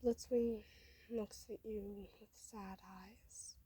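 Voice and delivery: ordinary delivery, nothing marked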